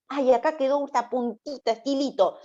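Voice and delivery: high-pitched devil pouncing on a leftover; mocking devil. Devil spotting a little sin left behind, high mocking voice. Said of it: "que el diablo diga decir".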